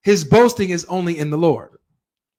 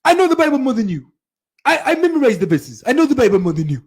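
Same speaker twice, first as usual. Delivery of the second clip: high-pitched mimicry of a boastful scholar; raised, rushed mock boasting; Zakir Naik, boasting; 7 semitones higher, faster than usual. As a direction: imitate someone insisting he knows best. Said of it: "he's the one saying".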